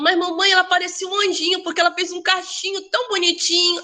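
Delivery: a hint of an eager child describing her friend; quoting her young daughter. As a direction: voice the excited six-year-old telling mom. Daughter quoted telling the story.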